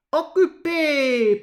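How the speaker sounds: loud, slow bark into the phone; shouting. Acted. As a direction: bellow it into the phone. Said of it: "brüllte er ins Telefon".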